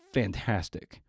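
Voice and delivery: normal talking voice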